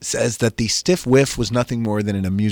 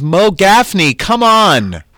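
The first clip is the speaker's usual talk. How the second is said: big, high show-host welcome; loud, raised announcer cheer; rousing guest introduction; 9 semitones higher, 7 dB louder, much slower than usual. Real, not acted. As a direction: call the guest on, building louder and higher.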